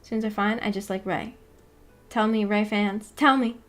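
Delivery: ordinary talk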